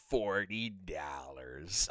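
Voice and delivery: slow drawn-out Midwestern "forty dollars"; Midwestern accent. Shown as a demonstration. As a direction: stretch the Midwestern vowels. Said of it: "'cause that's when my Midwestern accent comes out".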